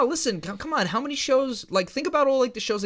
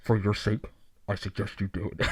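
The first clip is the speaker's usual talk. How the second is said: low helmet voice, much lower; hollow talking mask; 9 semitones lower, 5 dB quieter than usual. Retold or imitated. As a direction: intone it through a villain's mask. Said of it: "it sounds exactly like it when I do it".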